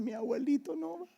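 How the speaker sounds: crying granddaughters. Retold or imitated. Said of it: "lloraban y decían".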